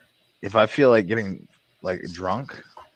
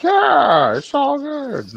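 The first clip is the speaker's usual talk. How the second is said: high, loud, hammed-up dude voice; a much higher hammed-up easygoing friend; mock laid-back guy; 4 dB louder, much slower than usual. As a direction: imitate him breezily brushing it off. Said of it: "he was like".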